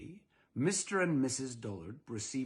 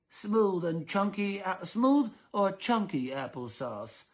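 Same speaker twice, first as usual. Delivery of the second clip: slow, higher, fretful question; fussy husband; 6 semitones higher, 3 dB louder, slower than usual. Acted. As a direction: ask it with prim worry. Mister Dullard asking.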